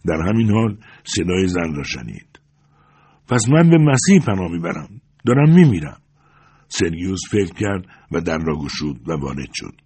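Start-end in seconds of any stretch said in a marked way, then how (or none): 3.3-6.0 high, loud dying appeal; raised, distressed woman's voice; desperate plea. A narrator's reading. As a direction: cry out to be rescued.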